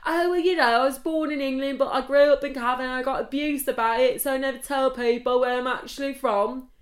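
loud, high mock English voice; hammed-up English accent, defensive explanation. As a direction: imitate a posh-sounding Englishman explaining himself loudly. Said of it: "You never once tell me you're English".

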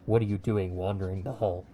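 low, muffled voice from under a helmet; a guard speaking through his helmet, lightly voiced.

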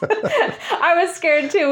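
laughing it out, slow and high; a shaky laugh through the words; giggling admission of fear. Real, not acted. Laughing while admitting fear.

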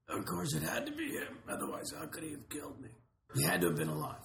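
weak, breathy dying gasp; fading last words of a dying man. Shown as a demonstration. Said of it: "I had to do that dying voice".

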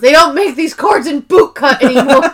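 mock offended lament; indignant complaint. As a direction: complain as if the trousers were the tragedy. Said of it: "he goes".

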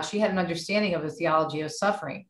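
normal talking voice

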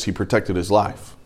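ordinary talk, nothing marked

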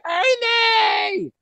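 piercingly high imitated call; Ernie's scream. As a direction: screech the name way up high, stretched out. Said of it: "So I hear".

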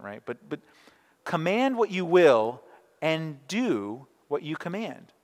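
normal talking voice

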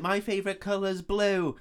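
high-pitched lightly voiced little girl; little sister. Little sister answering her brother's phone call, high childlike voice. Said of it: "His little sister picks it up".

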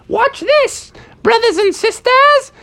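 very high falsetto boast; showing-off mother bear. Acted. Mother bear about to surf, very high showy voice. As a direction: call it out high and proud.

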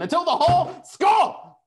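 high, booming rallying cry; rousing shout. Real, not acted. Podcast host signing off the episode, rousing shout. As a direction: bellow it like a toast to the crowd.

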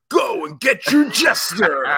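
loud, slow hyped ad voice; toy commercial announcer. Retold or imitated. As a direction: shout it like a kids' TV ad. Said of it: "it's like a toy commercial".

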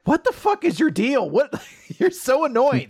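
high, laughing mock outrage; half-laughing exasperated yell; mock angry outburst. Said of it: "is like".

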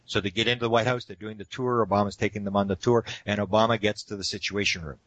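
usual speaking voice

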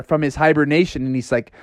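plain reading voice

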